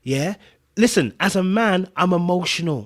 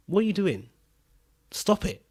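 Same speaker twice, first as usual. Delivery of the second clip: softer, restrained challenge with long pause; quiet firm rebuke; 3 semitones higher, 6 dB quieter than usual. Retold or imitated. Man recounting how he looked at her, quietly firm. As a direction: re-enact a controlled, quiet telling-off.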